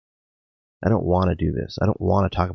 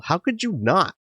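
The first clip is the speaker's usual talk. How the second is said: wanting to yell; 10 semitones higher than usual. Acted. Patient wanting to yell at her surprised therapist, mock yell. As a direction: throw the question up high, half a yell. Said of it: "Then I want to yell".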